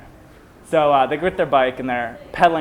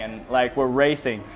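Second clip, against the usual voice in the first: ordinary talk, nothing marked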